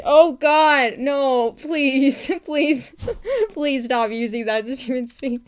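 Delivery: exasperated begging broken by laughs; mock pleading. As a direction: beg in comic agony, laughing between pleas. Comic begging to stop.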